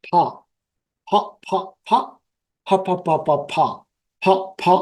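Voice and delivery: ফা repeated as a sound drill with open mouth. Shown as a demonstration. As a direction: stretch each ফা out wide, drilling. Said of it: "একটু বড় করে নিয়ে যাবেন".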